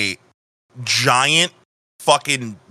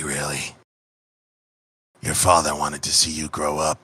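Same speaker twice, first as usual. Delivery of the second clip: gloating assassin; 6 semitones lower than usual. Acted. Assassin taunting the player about his dead father, low and cold. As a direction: savour the cruelty, low and unhurried. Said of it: "a smile on his face".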